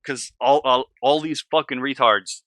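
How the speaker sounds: worked up and loud; a little heated. Real, not acted. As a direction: let real irritation push the voice up. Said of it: "I'm getting a little heated about this".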